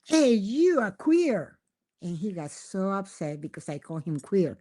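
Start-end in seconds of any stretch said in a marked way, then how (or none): 0.0-1.6 high, slow, drawn-out playful jab; insistent accusation. Retold or imitated. Young wife teasing her husband in a mistranslation, high and slow. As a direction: tease him with each word stretched. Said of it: "I told my husband".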